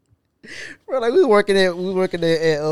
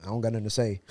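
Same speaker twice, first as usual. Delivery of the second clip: low, quiet retold reply; muted brush-off; 8 semitones lower, 11 dB quieter than usual. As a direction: withdraw quietly from the conversation. Retold reluctant reply to coworker.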